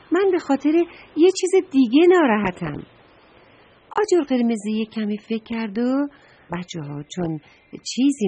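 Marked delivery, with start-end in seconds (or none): 0.1-2.8 higher, louder complaining voice; upset outburst. Acted. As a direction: raise it, a child-like upset protest. Trash can complains, upset.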